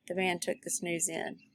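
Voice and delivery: ordinary delivery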